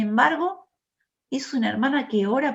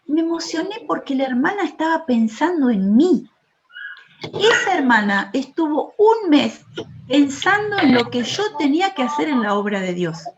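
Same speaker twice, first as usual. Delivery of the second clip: a touch raised and earnest, preaching; fervent preaching; 5 semitones higher, 5 dB louder than usual. Acted. Preacher telling the sisters of Faustina's gift, earnest preaching. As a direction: press the point with feeling. Preacher rising again, moved.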